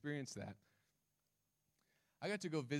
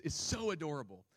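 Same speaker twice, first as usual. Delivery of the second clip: ordinary talk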